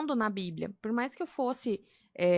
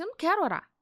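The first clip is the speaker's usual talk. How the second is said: higher, sharper flat refusal; retold outburst; 7 semitones higher, 4 dB louder, faster than usual. Refusing the mentor's prayer, replaying an angry outburst. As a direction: snap it back like your younger self. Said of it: "ela vinha falar comigo e eu explodia".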